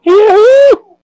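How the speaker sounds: high, loud joyful yahoo; excited whoop. Pretend. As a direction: cheer it out like a dolphin. Dolphin rolls natural twenty.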